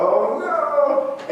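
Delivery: high, loud mock panic; a new teacher's sudden dread, hammed up; imitating himself panicking at the start of the year; dismayed. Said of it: "I was like".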